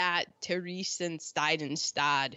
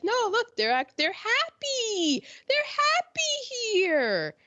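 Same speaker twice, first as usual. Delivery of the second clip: mock cheerful insistence; 14 semitones higher than usual. Acting propagandists insisting prisoners are happy.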